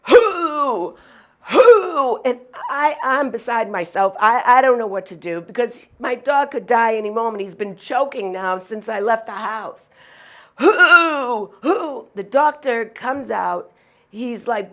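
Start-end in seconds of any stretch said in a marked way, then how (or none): 0.1-2.2 high, loud whooping coughs; imitated dog hacking cough. Owner imitates her dog's loud whooping cough in the waiting room. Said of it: "running up and down the waiting room going". 10.6-12.1 high, loud whooping coughs; imitated dog hacking cough. Owner imitates her dog's loud whooping cough in the waiting room. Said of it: "running up and down the waiting room going".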